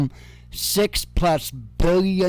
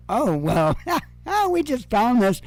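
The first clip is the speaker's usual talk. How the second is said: mock innocent officials; 7 semitones higher than usual.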